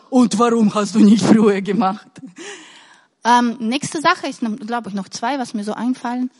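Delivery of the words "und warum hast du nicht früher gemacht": a hint of a nagging reproach, louder; mildly scolding, louder; reproachful. The wrong way to look at your own work, mock reproachful. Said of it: "Nicht so".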